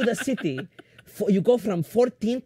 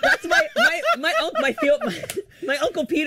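squealing with laughter, much higher; laughing. Real, not acted. Recognizing her uncle in the story, laughing hard. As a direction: laugh through the words in delight.

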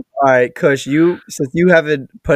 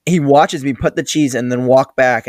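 ordinary delivery

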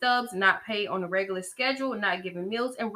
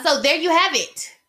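higher, louder catchphrase delivery; Tipsy Bartender; 6 semitones higher, 6 dB louder than usual. Acted. Podcaster borrowing a favorite YouTuber's sign-off, imitation. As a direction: punch out a show host's sign-off. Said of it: "tipsy bartender voice".

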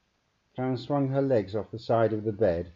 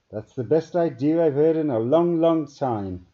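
high, loud, other-voiced scientist; relieved yes from a tired alien; weary agreement; 8 semitones higher, 9 dB louder than usual. A narrator's reading. Tired scientist agreeing with colleague, louder and higher.

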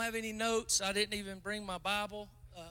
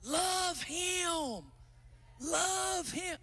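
high called-out cry, a hint of the preacher's yell; mild mock pulpit yell; retold yell; 10 semitones higher, much slower than usual. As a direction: call it out like a preacher urging the crowd. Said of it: "Pastor Karen yelled out".

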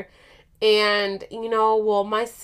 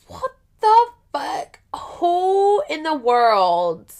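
high, drawn-out disbelief; incredulous outburst; 6 semitones higher, 4 dB louder, slower than usual. Retold or imitated. Her reacting to the visit attempt, high drawn-out disbelief. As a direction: stretch each word out, aghast.

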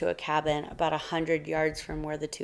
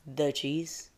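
softer echo, rising on "cheese"; unamused query; 2 semitones lower, 7 dB quieter than usual. A narrator's reading. Bear not amused by the mouse's reason, soft questioning echo. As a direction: repeat it quietly as a doubtful question.

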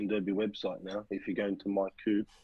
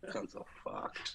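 whispered, slow and soft; muttered whisper; 11 dB quieter, much slower than usual. Real, not acted. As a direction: mutter it under your breath. Mock despair under breath.

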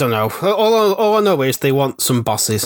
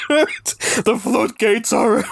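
laughing; slower than usual. Real, not acted. Cracking up after the bit.

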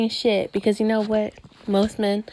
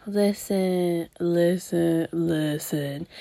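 dropping lower and softer on each listen; 5 semitones lower, 4 dB quieter, much slower than usual. Shown as a demonstration. Lowering her voice so men will listen. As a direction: sink the voice down to be heard. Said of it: "you know why I have to lower my voice?".

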